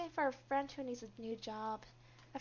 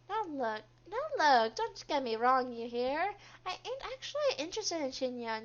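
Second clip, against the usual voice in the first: lively, pitched-up character voice, resentful; grating, indignant coworker; 4 semitones higher, 6 dB louder than usual. Acted. Coworker insists she isn't interested in Shinya, grating indignation. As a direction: complain in a put-on grating voice.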